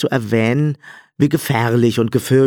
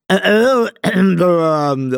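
high, loud hesitant throat-clearing noises; acted ahem in a high character voice; 9 semitones higher, 4 dB louder, much slower than usual. A narrator's reading. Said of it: "räusperte er sich".

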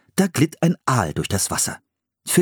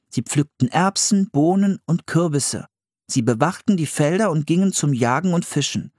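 put-on higher-pitched boy reading out facts; Philipp reading aloud; 8 semitones higher than usual. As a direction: read from the book as the young brother. Philipp reads from the book.

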